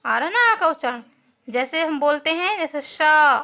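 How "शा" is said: शा with the आ held long; drawn-out शा showing the long sound; long vowel. Shown as a demonstration. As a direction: stretch the vowel in शा. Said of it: "थोड़ा हम लंबा बोलते हैं".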